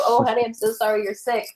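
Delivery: soothing sympathy. Retold or imitated. Host modeling soothing words to a sick spouse, tender. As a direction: coo it gently to a sick partner. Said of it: "you wanna go".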